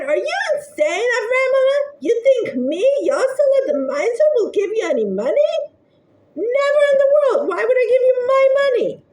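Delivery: high, loud, raging miser; furious shout. Acted. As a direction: bellow it like a furious old miser. Miser throws visitor out, shouting.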